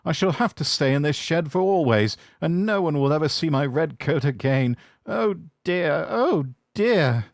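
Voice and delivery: high, whimpering engine close to tears; sad lament. Acted. James in the shed, sorry for himself, high and sad. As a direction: wail it sadly, near crying. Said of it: "he thought sadly".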